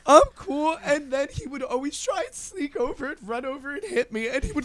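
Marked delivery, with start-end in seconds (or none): 0.1-0.8 high mock reassurance; imitating drunk friend. Drunk friend assures the mother, mock innocent. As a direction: act a drunk pretending to be fine. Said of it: "keeps trying to be like".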